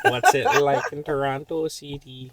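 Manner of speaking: slow mock Toronto slang. Cohost parodying a Toronto podcast intro in Toronto slang. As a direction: ham up the Toronto accent. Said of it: "the Toronto slang version".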